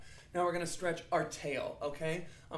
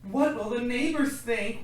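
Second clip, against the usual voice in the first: worried mother; 7 semitones higher, 7 dB louder than usual. Acted. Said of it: "worried his mother".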